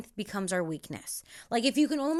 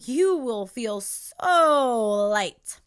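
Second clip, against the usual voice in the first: drawn out very slowly and louder; slow soothing promise; 6 dB louder, much slower than usual. Real, not acted. Vlogger reassuring viewers, slow drawn-out promise. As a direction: stretch every word of "so light" out.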